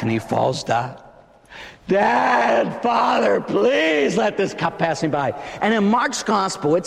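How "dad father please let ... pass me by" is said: high, drawn-out crying plea; desperate pleading. Acted. Preacher as Jesus pleading with his Father, anguished cry. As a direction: call out and beg, pitched high.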